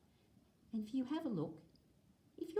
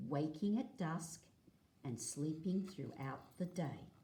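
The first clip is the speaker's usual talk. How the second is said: clear, lively read-aloud for a class; reading to children. Acted. Teacher reading a dingo book to the class, lively read-aloud. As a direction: read the page out to the kids, a touch brighter.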